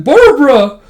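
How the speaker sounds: high, loud joyful cry; acted calling out. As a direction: call out to a long-lost wife. Old man calls his wife.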